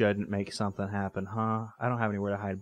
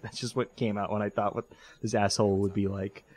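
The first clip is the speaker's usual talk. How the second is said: normal talking voice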